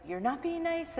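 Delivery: replaying her yell at the family, lightly; mock yell. Mom yelling at her family, mild mock yell. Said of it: "yell at everybody and say".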